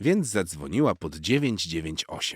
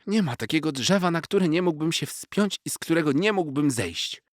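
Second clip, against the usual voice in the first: put-on higher, proud young cat voice; boastful cat; 10 semitones higher, 4 dB louder than usual. A narrator's reading. Young cat bragging to the squirrel, higher voice. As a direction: brag like a cocky kitten.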